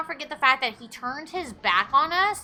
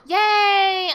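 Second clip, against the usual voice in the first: excited cheer; 7 semitones higher, 8 dB louder than usual. Real, not acted. Game shows favourite activity.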